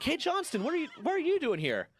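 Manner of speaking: squeaky imitation of the boss; mock chief. Chief surprised to see him working late, high mock voice.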